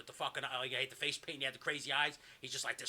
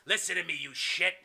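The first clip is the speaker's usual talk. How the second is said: lightly voiced tough boss giving a threat; a hint of an angry bully, pitched up; loud-edged scolding of an underling; Kevin Sullivan menace; 3 semitones higher, 8 dB louder than usual. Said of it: "Kevin Sullivan goes".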